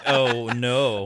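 loud mock horror; dawning dread. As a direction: play the sinking feeling of realizing too late, hammed up. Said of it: "you're just like".

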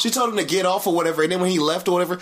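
ordinary talk, nothing marked

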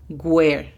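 "where" said as "gwer" with a hard G at the start; wrong G sound. Shown as a demonstration. Teacher shows the common mistake: "where" as gwer. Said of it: "A menudo se pronuncia".

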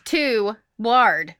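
"to ward" split in two separate words; two syllables. Shown as a demonstration. Host argues from the spelling, two separate syllables. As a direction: break "to ward" apart with a pause. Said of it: "that's not one syllable".

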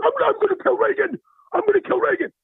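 high-pitched Rusty outburst; ranting yell. Acted. Rusty raging about hating Reagan, high-pitched rant. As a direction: rant it in a high, yelling character voice.

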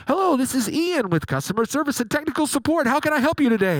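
a hammed-up customer service welcome; polished support agent. Ian from customer service greeting the caller, hammed-up chipper. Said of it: "more impressions of DirecTV people".

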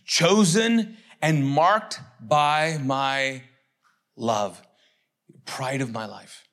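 very slow, measured divine declaration; God's voice. Acted. God declaring love for his son, slow solemn voice. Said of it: "He says".

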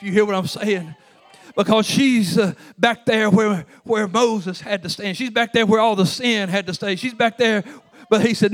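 loud, high, driving sermon delivery; full-force preaching. Acted. Preacher drives the point home to his congregation, loud full-force preaching. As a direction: preach it hard to the whole church.

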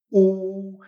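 U as one long pure vowel; doble U. Shown as a demonstration. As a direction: hold U long, no consonant before it. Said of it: "como si hubiera dos U's". (U larga, como dos úes seguidas)

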